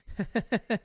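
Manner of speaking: put-on creepy girlish giggle; a short unsettling laugh; eerie giggle. Rena laughs eerily.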